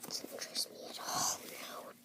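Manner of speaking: very soft, unvoiced whisper. Playing alone, dismissing what holds no interest, whispering. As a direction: whisper it as a private aside. Muttered aside to self.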